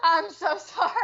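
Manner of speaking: embarrassed apology breaking into laughs; laughing apology. Real, not acted. Laughing apology after accidental on-air yell.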